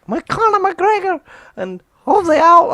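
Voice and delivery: high, loud mock Irish caller; hammed-up Irish caller asking for the fight; bad Irish accent. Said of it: "what's an Irish accent?".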